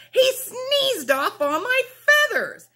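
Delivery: angry. Acted. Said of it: "angrily stamping her foot".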